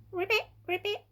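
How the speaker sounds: high croaky frog voice; frog croak. A narrator's reading. Frog answers the owl.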